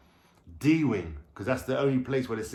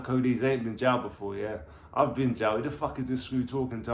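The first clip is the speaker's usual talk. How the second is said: ordinary talk, nothing marked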